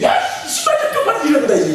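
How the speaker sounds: high, loud mock telling-off; complaining kids. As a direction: yell a complaint like annoyed kids. Acting out kids' complaint about shoes.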